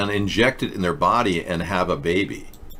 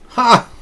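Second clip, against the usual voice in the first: mocking ha; 13 semitones higher, 7 dB louder than usual. Real, not acted. Mocking the caller's surprise.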